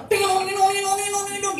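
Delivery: high, twanging vocal guitar riff; imitated guitar. Preacher imitating the church guitarist, voiced guitar sound. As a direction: mimic a jangling guitar with your voice. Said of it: "yang main gitar".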